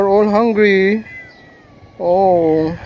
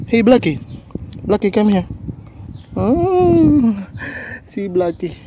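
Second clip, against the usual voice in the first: ordinary talk, nothing marked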